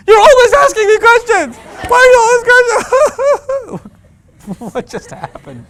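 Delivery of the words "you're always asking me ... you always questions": put-on shrill screaming teen, much higher and louder; teen outburst. Teen screaming at mom over questions, very high and loud. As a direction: explode like a teen losing it.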